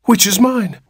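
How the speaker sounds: high-pitched, breath-caught question; anxious gasp. A narrator's reading. New father asks which baby is his, gasping. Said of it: "gasped Mister Button".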